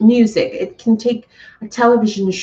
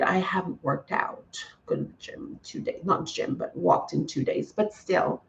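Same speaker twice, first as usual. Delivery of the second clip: hushed admission under breath; whispered aside; 3 semitones lower, 11 dB quieter than usual. Real, not acted. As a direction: drop to a whisper, sheepishly. Quiet confessing aside to viewers.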